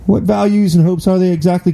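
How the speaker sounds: mocking question. Real, not acted. Mocking the clip's claim.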